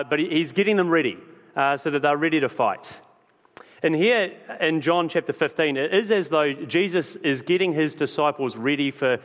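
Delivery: ordinary delivery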